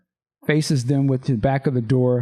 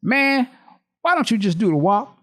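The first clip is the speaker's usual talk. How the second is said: mocking taunt; 8 semitones higher than usual. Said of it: "And then Morris says".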